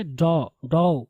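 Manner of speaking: "डॉ" with a rounded aw, "daw"; correct first syllable. Shown as a demonstration. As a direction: drill "डॉ" as a separate DAW. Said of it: "डॉटर नहीं". ("डॉ" کو گول آواز میں "ڈَو" کہنا)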